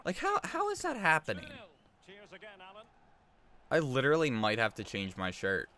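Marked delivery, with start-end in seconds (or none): 0.0-1.5 very high, incredulous questioning; incredulous bafflement. Real, not acted. Streamer puzzled by the camera glitch, incredulous. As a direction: squeak out the question in disbelief.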